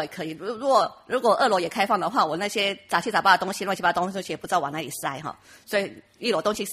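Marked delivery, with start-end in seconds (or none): none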